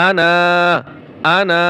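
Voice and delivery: আনা with the final a held long; elongated vowel. Shown as a demonstration. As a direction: stretch the end of আনা. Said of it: "টান হবে".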